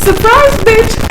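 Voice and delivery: high, loud, slow surprise; mock-triumphant shriek; sassy reveal. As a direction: drag it out with cheeky triumph. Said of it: "revealing herself".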